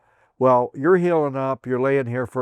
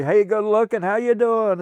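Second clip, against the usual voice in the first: high, cheery flirtatious hello; bubbly greeting; 9 semitones higher than usual. Retold or imitated. Gracie greeting each soldier in the ward, high and cheery. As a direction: brighten the whole ward with it.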